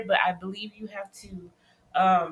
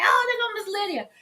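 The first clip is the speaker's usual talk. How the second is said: high, bright "oh" of spotting a friend; mock surprised exclaim; 10 semitones higher than usual. Host recalls spotting Miss Lydia, exclaiming high and delighted. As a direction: pop up high like you just spotted her. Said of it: "I was like".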